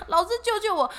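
high frightened cry for help; mock panicked plea. Retelling her plea to the teacher, mock frightened cry.

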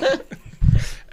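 high airy laugh. Real, not acted. Laughing at chalupa joke.

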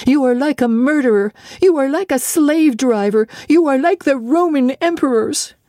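put-on high, rising outcry of a wronged girl; girl lashing back at her tormentor; angry accusation. A narrator's reading. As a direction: hurl each comparison at him. Jane accuses John in anger.

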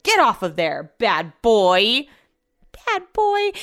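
scolding shout. Retold or imitated. Owner scolding the dog for climbing the glass shelf, loud stern shout. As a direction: bark it at the dog like you mean it. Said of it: "that's exactly how I said it too".

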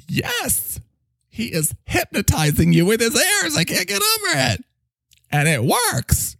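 high, swooping character voice; astonished sidekick. Acted. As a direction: marvel in a high, theatrical voice. Plays astonished Mike.

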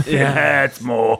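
slow, drawn-out villain impression; Alan Rickman. Co-host correcting a film villain's line, slow mock Rickman. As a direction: stretch it like a hammy screen villain. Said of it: "Is that how I do Rickman?".

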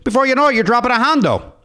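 put-on high, hammy Chicago guy accent. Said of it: "I gotta say that to get the voice".

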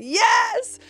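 high, loud triumphant cheer; cheering yes. Retold or imitated. Finally seeing spirits, a high triumphant cheer. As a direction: shout it like a win.

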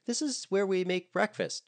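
high, bright tour-guide voice. A narrator's reading. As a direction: play the chirpy tour guide. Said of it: "determined to play tour guide".

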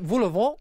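vol au vent with a nasal French vent. Shown as a demonstration. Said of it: "how to say".

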